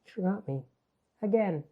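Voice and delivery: a mild hint of a hurt, forgotten friend; umbrella's reproach. The umbrella chiding her owner, lightly sulky voice. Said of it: "she's like".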